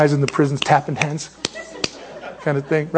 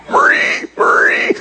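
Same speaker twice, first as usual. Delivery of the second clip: laughing; 9 semitones higher, slower than usual. Real, not acted. Laughing name call.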